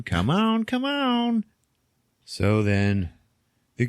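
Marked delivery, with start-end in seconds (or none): 0.0-0.6 high, wheedling come on; mock witch begging high; witch pleading. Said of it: "the fat one, just goes". 0.6-1.5 very high put-on squeaky come on; mock witch squealing in a much higher voice; witch pleading. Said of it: "the fat one, just goes".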